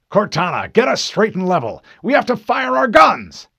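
loud, desperate battle command; urgent command. Acted. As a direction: yell orders as the ship tumbles. Captain shouts orders as ship tumbles.

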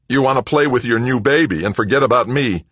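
high, loud, jealous sulk; sullen. A narrator's reading. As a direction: accuse her like a hurt child. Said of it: "Then, sullenly".

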